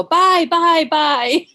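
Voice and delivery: loud called out buy; yelling out. Retold or imitated. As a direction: yell it from a car window. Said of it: "he yells out his window".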